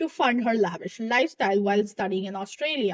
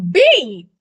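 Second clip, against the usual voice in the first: loud; 3 semitones higher, 11 dB louder than usual. Retold or imitated. Calling principal a name.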